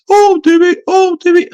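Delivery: very high, squeaky taunt; squeaky mocking voice. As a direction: squeal it to wind someone up. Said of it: "that high-pitched, stupid voice".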